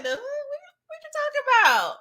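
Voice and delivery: high squeaky baffled question; high confused. Retold or imitated. Dallas baffled by the flirting, high and squeaky. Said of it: "She like".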